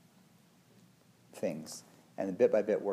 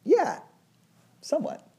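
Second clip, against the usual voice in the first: high imitating voice. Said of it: "She was like".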